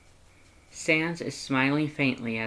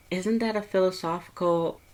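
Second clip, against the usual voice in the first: higher, lighter heroine voice; curious young woman; 7 semitones higher than usual. Acted. Heroine asking Sans about Papyrus's call, higher and curious. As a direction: ask Sans gently, curious.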